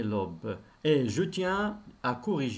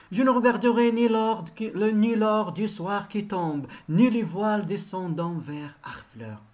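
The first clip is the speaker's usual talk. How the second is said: declaimed quotation, starting raised and settling; poem recitation; 8 semitones higher, 5 dB louder than usual. Acted. Teacher quoting the poem as proof for pupils, declaimed. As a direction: quote the verse in a ringing reciting voice.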